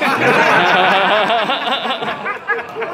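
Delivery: high, loud peal of laughter; burst of laughter. Real, not acted. Reader laughing at own pun, high loud laughter. Cracks up mid reading.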